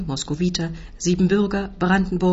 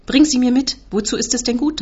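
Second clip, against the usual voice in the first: loud, high-pitched order; exasperated raised voice; shouted demand; 7 semitones higher, 5 dB louder than usual. A narrator's reading. Uncle keeps shouting at narrator.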